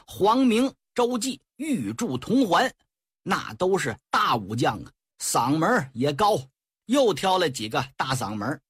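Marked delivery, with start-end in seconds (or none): none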